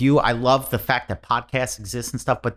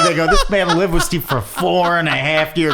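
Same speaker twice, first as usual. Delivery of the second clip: high, loud insistence through laughter; laughing; 10 semitones higher, 5 dB louder than usual. Real, not acted. Comic insisting the story is no lie, laughing. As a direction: retell it while cracking up. Retelling while still laughing.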